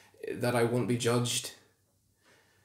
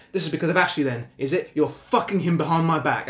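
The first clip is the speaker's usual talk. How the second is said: raised, higher-pitched angry accusation; jealous accusation; 4 semitones higher, 4 dB louder than usual. Acted. Husband accusing his wife of sleeping with Ashley, angry. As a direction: fire the accusation at her.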